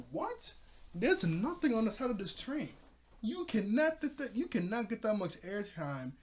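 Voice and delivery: high-pitched disbelief; incredulous. Retold or imitated. Narrator objecting to the train stunt, incredulous. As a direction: protest in astonishment, pitch jumping up.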